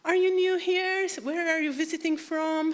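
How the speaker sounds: imitated keen greeter; welcoming church lady. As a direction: quiz a newcomer in a high, friendly voice. Voicing church lady greeting newcomers.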